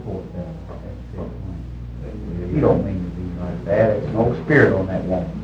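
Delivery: low, soft aside; subdued, low-pitched retelling; pitying aside, retold. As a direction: drop low and murmur with pity.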